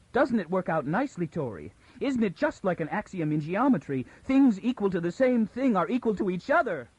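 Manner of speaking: a woman talking herself into near-hysteria; edge of hysteria. Acted. Bayta to Toran, piecing it together, near hysteria. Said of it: "She was at the edge of hysteria".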